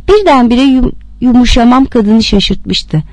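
plain reading voice

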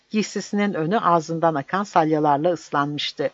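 ordinary delivery

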